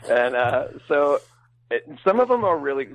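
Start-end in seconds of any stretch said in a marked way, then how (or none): none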